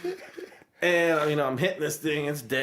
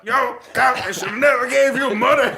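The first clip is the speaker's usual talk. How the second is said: excited shout; 8 semitones higher, 6 dB louder than usual. Retold or imitated. Slamming the broom.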